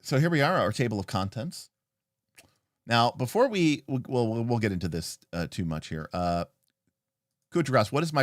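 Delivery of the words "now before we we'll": usual speaking voice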